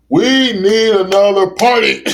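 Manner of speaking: high, loud, drawn-out rallying holler. Real, not acted. Host hollers a slogan to podcast listeners, hollering. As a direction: holler it slowly to the crowd. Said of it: "I keep hollering".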